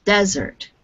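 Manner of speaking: "desert" stressed up front, falling away after; teacher's model "desert", said DEZ-ert; stress on first syllable. Shown as a demonstration. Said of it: "stress on the first syllable".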